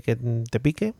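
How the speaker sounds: ordinary talk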